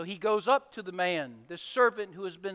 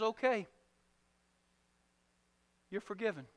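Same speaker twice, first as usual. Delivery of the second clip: forgiving king; 5 dB louder than usual. Acted. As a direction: let him off gently, with compassion. King granting pardon gently.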